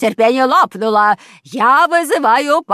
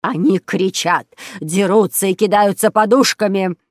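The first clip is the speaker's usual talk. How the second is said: loud, furious accusation; seething with rage; 2 semitones higher, 6 dB louder than usual. A narrator's reading. Neighbour accusing children to police, seething with rage. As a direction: spit out each charge angrily. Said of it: "шипела от ярости фрау Штрудель".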